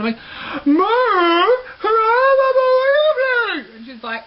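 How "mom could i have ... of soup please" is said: high, muffled wail at mom; loud, garbled plea, pitched way up; getting louder. Retold or imitated. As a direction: whine it loudly through an unusable mouth. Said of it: "you're just getting louder".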